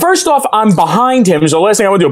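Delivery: usual speaking voice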